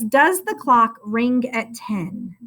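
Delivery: slow, clear reading of the answer; slow dictation. Shown as a demonstration. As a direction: read it slowly to check. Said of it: "the sentence was".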